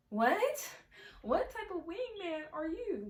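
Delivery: high-pitched, incredulous outburst; high, drawn-out indignant questioning; indignant disbelief. Retold or imitated. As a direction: squeal it in outraged disbelief. Reacting to friend's betrayal.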